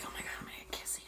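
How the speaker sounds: breathless secret whisper. Retold or imitated. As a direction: whisper it giddy and fast. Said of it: "she's like".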